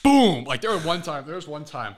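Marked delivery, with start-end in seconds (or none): 0.0-0.4 high, loud burst on one word; explosive impact word. Retold or imitated. Storyteller acting out the hallway tackle, loud high boom. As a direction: punch it out like a body hitting lockers.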